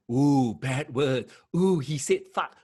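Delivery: slow, exaggerated scandalised voice; mock pearl-clutcher. Podcast host mocks censorship fans, slow scandalised voice. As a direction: play a prissy critic gasping at swearing.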